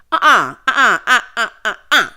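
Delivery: loud, repeated uh-uhs; emphatic refusal. Real, not acted. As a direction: cut it off with loud disapproval. Rejects the catering breakfast scene.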